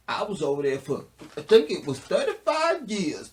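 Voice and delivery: mock slave testimony swelling to a high-pitched insistence; runaway slave. Reviewer voicing the slave's thirty-five years, hammed-up runaway slave. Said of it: "said the runaway slave".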